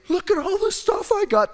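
high, excited boasting husband; gloating Abraham. Retold or imitated. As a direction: brag like a kid with new toys. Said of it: "This is Abraham's response".

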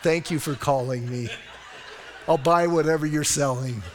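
chuckling through a mock-sweet reply; laughing reply. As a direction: laugh while brushing the caller off. Joking reply to a robocaller.

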